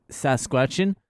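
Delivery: normal talking voice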